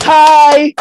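a loud, high cry of his name; excited call. Real, not acted. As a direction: call out to him, all fired up. Hyped fan calls out to a fighter.

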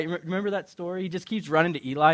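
ordinary delivery

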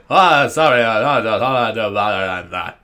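loud, mock punk-singer voice; hammed-up stammering rock-star impression. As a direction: put on a rock singer's voice, loudly. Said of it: "I sound like [name]".

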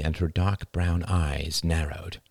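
plain reading voice

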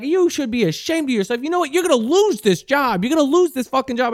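high-pitched mock tirade; angry threat. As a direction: imitate an outraged woman threatening someone's job. Acted woman threatening in quarrel.

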